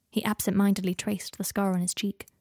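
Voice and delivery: ordinary delivery, nothing marked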